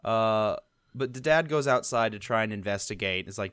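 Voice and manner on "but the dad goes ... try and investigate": normal talking voice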